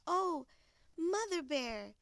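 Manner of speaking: high, loud, delighted cub; delighted surprise. Acted. Little Bear sees Mother Bear with cake, delighted cry. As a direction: exclaim with joyful surprise, pitched well up.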